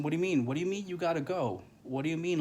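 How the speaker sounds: high-pitched, disbelieving retold protest; incredulous protest. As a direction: replay your disbelief, pitch jumping up. Retold protest at his leaving.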